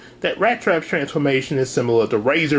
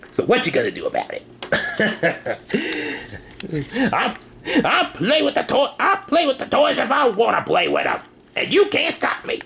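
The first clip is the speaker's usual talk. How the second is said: defiant; 9 semitones higher than usual. Real, not acted. Defiant toy collector taunt.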